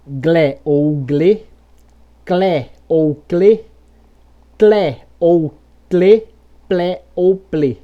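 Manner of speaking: slow syllable pairs like glé, glê, open then closed E; bridged consonant plus L. Shown as a demonstration. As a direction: glide each consonant through L into E, as in plé, plê. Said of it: "uma ponte entre consoante e vogal".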